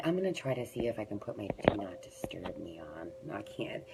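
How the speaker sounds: whispered aside to herself; whispered muttering aside. Real, not acted. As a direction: drop to a whisper as she fiddles with the phone. Aside about phone settings.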